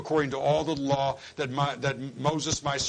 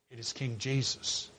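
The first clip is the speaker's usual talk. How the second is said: usual speaking voice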